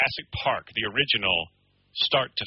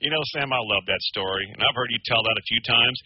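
lightly voiced actor quote, lower; Jeff Goldblum; 7 semitones lower than usual. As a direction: lean in with a low, confiding remark. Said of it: "Jeff Goldblum leans in and goes".

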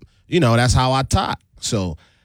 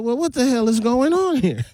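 1 confused; 11 semitones higher than usual. Retold or imitated. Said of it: "I'm like".

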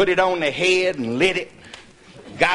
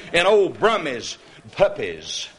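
ordinary delivery

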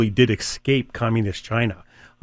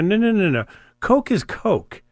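high, slow and emphatic, drawn-out Coke is Coke; mock-exasperated, spelling it out for the family; insistent correction; 9 semitones higher, slower than usual. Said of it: "You go".

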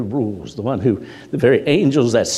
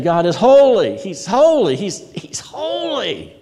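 building from raised cry to very high, thinning call; intensifying repetition; 9 semitones higher, 3 dB louder, slower than usual. Acted. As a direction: push each holy higher, stretch the last. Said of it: "To intensify this phrase".

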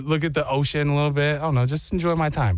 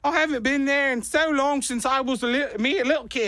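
higher, hammed-up Aussie accent; fake Australian accent; 8 semitones higher than usual. Pretending to Australians he grew up there, hammed Aussie accent. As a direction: lay on the Aussie cliché.

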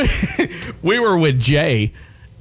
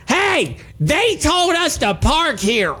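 high, slow, indignant protest with every syllable jabbed; indignant pointed retort; 11 semitones higher, slower than usual. Retold or imitated. Retorting to the guys who yelled at him, high and indignant. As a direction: tap out each word like wagging a finger. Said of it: "in this exact tone, pointing my finger".

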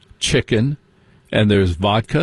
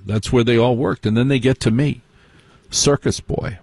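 ordinary talk, nothing marked

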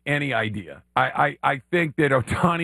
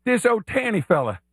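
Billy Payne; 5 semitones higher than usual. Acted. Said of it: "as Billy Payne?".

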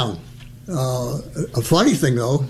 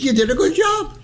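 pitched-up dentist praise; high approving voice; 13 semitones higher than usual. Retold or imitated. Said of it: "he said".

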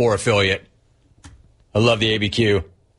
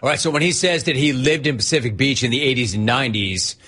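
ordinary talk, nothing marked

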